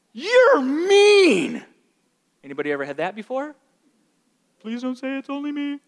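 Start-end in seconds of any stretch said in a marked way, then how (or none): 0.1-1.6 put-on very high, loud child's whine; upset child. Warned child lashing out at parent, tantrum whine. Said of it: "with a child where they're disobeying". 4.6-5.8 small, high pleading voice; mock plea. Preacher to silent congregation, mock pleading.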